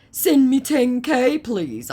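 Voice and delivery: high, slow whiny beg; mock scammer begging. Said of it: "I mocked him".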